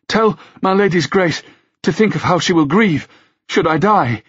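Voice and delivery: high-pitched desperate appeal; panting plea. A narrator's reading. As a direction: plead high, as if short of breath. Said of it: "said between panting breaths".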